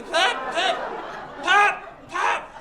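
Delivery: loud, explosive mock hacking; imitated coughing. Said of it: "he's coughing into my mouth".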